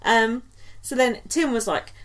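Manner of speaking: ordinary delivery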